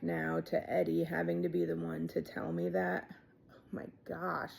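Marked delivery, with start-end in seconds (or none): 3.5-4.5 whispered aside. Real, not acted. Overwhelmed aside.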